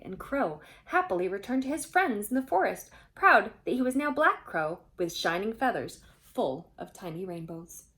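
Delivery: usual speaking voice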